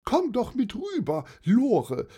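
high-pitched aunt calling a friend over. A narrator's reading. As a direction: call across in a high auntie voice. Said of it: "rief Tante Dorothee".

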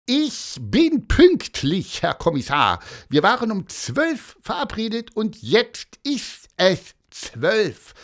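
high, indignant insistence, words spaced out; squeaky, emphatic self-defence; close to losing temper. A narrator's reading. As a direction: spell it out slowly in a piqued, high voice. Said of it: "war kurz davor, richtig sauer zu werden".

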